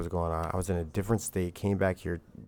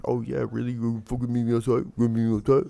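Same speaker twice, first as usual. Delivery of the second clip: higher, louder sneering taunt; mocking the coach; 5 semitones higher, 5 dB louder than usual. Imitating the old coach daring him outside, mocking. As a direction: mimic his dare with a sneer.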